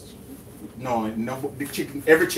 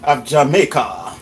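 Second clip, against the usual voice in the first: slow, drawn-out grand announcement; 3 semitones lower, 7 dB louder, much slower than usual. Pretend. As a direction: stretch it like a host.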